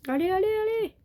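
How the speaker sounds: excited cheering. Real, not acted. Urging himself on in game.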